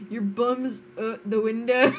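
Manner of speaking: a Scottish phrase cracking up with laughter; laughing attempt at a Scottish accent; laughing Scottish line. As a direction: try the Scottish saying but giggle through it. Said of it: "Scottish phrases".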